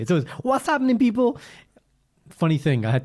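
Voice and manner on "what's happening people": high-pitched catchphrase, called out; imitated podcast intro. Pretending to do the co-host's podcast intro, high catchphrase call. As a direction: belt out a show-opening greeting, pitched way up. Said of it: "That's how you say it".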